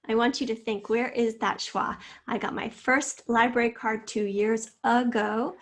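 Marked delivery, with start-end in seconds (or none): none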